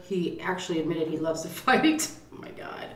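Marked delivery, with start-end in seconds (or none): 2.3-2.9 breathy whisper of exasperation; whispered aside. Real, not acted. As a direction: whisper it under your breath. Exasperated aside about Aaron.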